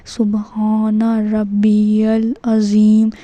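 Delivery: slow, stretched سبحان ربی العظیم; correct recitation. Shown as a demonstration. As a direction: model سبحان ربی العظیم syllable by syllable with long vowels. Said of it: "اس طرح سے".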